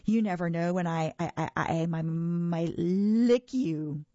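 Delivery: a hammed-up threat that falls flat; trying to sound terrifying. A narrator's reading. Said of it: "trying to sound terrifying".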